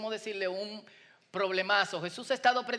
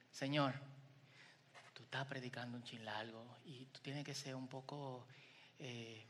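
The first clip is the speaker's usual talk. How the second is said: much softer, low, hushed complaint to the master; pleading disciples; 9 semitones lower, 16 dB quieter than usual. Retold or imitated. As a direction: murmur the worry as the disciples. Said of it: "le dicen". (discípulos quejándose en voz baja y grave)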